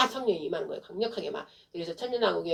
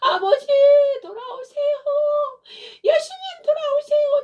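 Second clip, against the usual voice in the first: very high, loud tearful wail; crying out; 11 dB louder than usual. Acted. Preacher calling Father and Jesus to return, loud tearful cry. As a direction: wail the plea to heaven at full force. Prayer cried out at full force.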